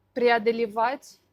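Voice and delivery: ordinary delivery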